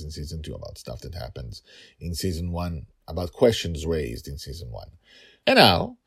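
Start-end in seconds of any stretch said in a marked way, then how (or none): 5.5-6.0 high, loud, slow announcer call; grand announcer. Acted. Host introducing the credits in a booming announcer voice. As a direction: herald the credits like a showman.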